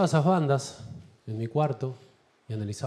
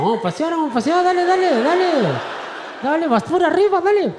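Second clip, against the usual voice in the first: dog begging its owner to go for a walk, much higher; 11 semitones higher than usual. Retold or imitated. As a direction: yip it like an excited little dog. Voicing his dog every morning.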